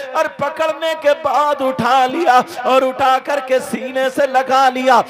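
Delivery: loud, passionate sermon delivery; fiery preaching. Acted. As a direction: preach it at full force to the crowd. Full-force preaching of the story. (जोशीली तक़रीर, पूरी ताक़त से)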